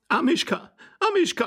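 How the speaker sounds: shrill-pitched distressed calling. A narrator's reading. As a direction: call for the pet in a high, distressed voice. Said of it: "rief die Dame".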